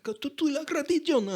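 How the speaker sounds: high-pitched put-on Spanish; hammed-up Spanish accent; mock Spanish-speaking pope. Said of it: "He's like".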